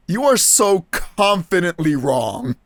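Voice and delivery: ordinary talk, nothing marked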